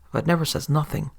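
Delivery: usual speaking voice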